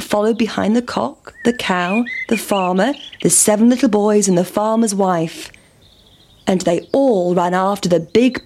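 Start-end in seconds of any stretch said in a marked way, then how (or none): none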